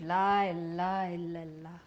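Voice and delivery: low, soft chant fading away; murmured refrain. A narrator's reading. Overwhelmed mother repeating words to herself, murmured.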